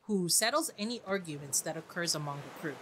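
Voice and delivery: ordinary delivery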